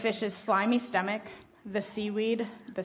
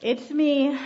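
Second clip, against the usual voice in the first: louder, higher mock groan; groaned confession; 5 semitones higher, 7 dB louder than usual. A narrator's reading. Jonah confesses to the sailors, groaning. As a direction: own up with an emphatic moan, lightly acted. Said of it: "Jonah groaned".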